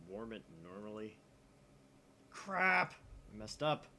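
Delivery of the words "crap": mock yell. Mock game line.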